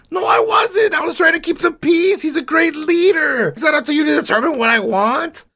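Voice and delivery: high, shouted disgust at Ptolemy; outraged Caesar. Acted. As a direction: yell at him like a furious general. Acted quarrel, Caesar furious.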